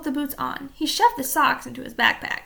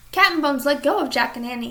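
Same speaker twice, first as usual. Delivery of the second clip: ordinary delivery, nothing marked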